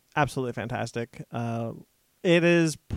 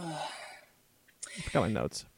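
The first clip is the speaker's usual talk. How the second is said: hushed aside whisper; 11 dB quieter, much slower than usual. Real, not acted. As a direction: whisper so only friends hear. Aside while friend falters.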